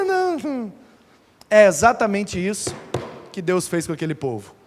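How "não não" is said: put-on scared child. Child backs down.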